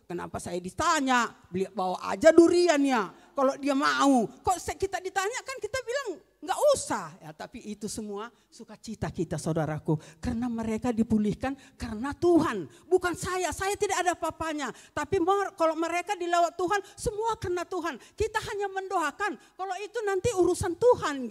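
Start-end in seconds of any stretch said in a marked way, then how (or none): 0.1-7.1 loud exclamation. Acted. Loud aside to congregation. 10.2-21.3 higher and louder, ringing proclamation; full-force preaching. Acted.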